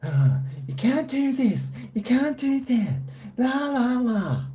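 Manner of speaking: high, mocking nagging voice; mocking YouTube. Mocking YouTube's rules lecture in a high whiny voice. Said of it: "telling me all about how".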